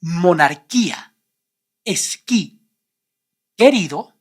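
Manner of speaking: monarquía, esquí, querido with silent u; k sound straight into the vowel; Spanish words. Shown as a demonstration. Teacher revealing the practice answers. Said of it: "If you said".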